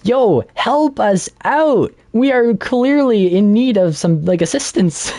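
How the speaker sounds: high-pitched mock yell, drawn out; retold screaming. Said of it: "David's screaming at them".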